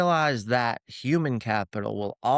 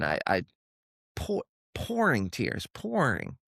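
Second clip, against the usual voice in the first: choked up retelling; 3 dB quieter than usual. Retold or imitated. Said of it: "I broke down".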